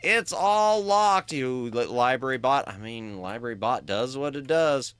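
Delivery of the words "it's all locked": loud, high, drawn-out dismay; wailed protest. Acted. Player finding the window now locked, wailed protest. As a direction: wail it, stretching the words slowly.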